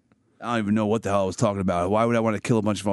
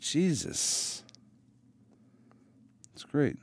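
breathy aside; 4 semitones higher, 6 dB quieter, much slower than usual. Real, not acted. Reacting to joke.

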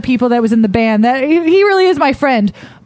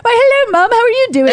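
high-pitched, mock British phone greeting; exaggerated British hello; British accent; 10 semitones higher than usual. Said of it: "in a British accent".

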